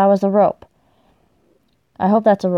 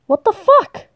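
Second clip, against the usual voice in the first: very high, rising question; baffled disbelief; 11 semitones higher than usual. Real, not acted. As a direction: squeak it out in disbelief. Repeated disbelief at game events.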